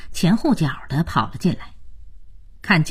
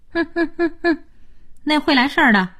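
high-pitched sneer after a snicker; snicker, then mock him in a high voice; snide; 12 semitones higher, faster than usual. Housemate gossiping about the newcomer's flattery, snide.

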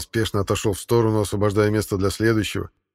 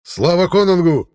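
high, loud rallying shout; fervent cheer; 9 semitones higher, 7 dB louder than usual. A narrator's reading. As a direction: cheer the king loudly. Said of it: "крикнул чей-то задорный голос".

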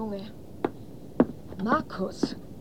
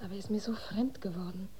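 whisper; 4 semitones lower, 10 dB quieter, faster than usual. Acted. Worried mother at night.

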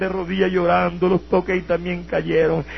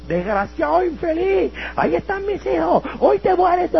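much higher, mock-threatening cry; angry mother; 11 semitones higher, slower than usual. Mother warning her son he will die today, angry. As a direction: threaten him like a fed-up old mother.

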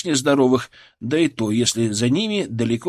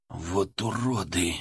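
soft slow hiss, much lower; 6 semitones lower, 8 dB quieter, slower than usual. A narrator's reading. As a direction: spit it out under breath. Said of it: "прошипел вдруг Ларионов".

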